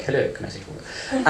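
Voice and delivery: mildly imitated English server voice; English accent. An English fast-food cashier greeting a customer, in a low-key English accent. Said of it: "if you're in England, they're like".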